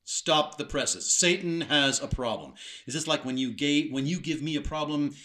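mocking, loud and sarcastic. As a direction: sneer it out with mock drama. God sarcastically mocks Satan.

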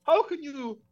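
high-pitched, hammed-up Irish protest; mock Irish accent. Farmer pleading for the farm, high mock Irish accent. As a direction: lament in a badly faked high Irish accent.

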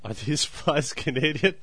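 ordinary talk

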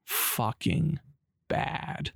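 usual speaking voice

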